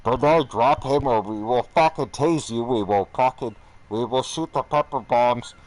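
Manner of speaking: loud, forceful commands; guard's shouted warning. Retold or imitated. Guards threatening to tase Bernard, shouted orders. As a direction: bellow a warning across the tier.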